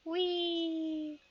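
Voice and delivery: high, airy little cry of glee; playful whee. Real, not acted. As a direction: squeal it like a slide ride. Jumping down into darkness.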